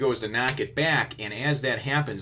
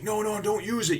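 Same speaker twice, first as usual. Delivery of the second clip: much higher-pitched mock panic; alarmed plea; 12 semitones higher than usual. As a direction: imitate a crew begging in alarm. Retelling crew's alarmed plea.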